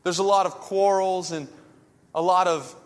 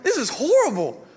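high, louder mock anguish; mock despair; 7 semitones higher, 4 dB louder than usual. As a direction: overplay a kid's dismay at his chore. Kid jealous of brother's chore.

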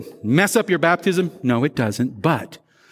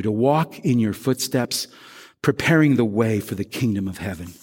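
low, measured pastoral prayer; 4 semitones lower than usual. Real, not acted. Pastor asking God's forgiveness after the sermon, low measured prayer. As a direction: pray in a lowered voice with pauses.

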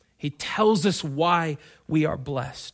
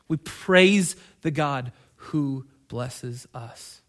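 slow; 3 semitones lower, much slower than usual. Real, not acted. Slow drawn refrain, louder.

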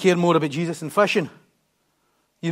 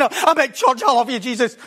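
excited, higher-pitched gushing; Peter; 6 semitones higher, 3 dB louder than usual. Acted. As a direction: act out eager devotion. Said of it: "It's, like, excited again".

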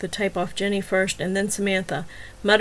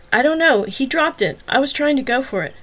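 higher, louder flustered excuse; 5 semitones higher, 4 dB louder than usual. Acted. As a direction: scramble for an answer. Wagner flustered under questioning.

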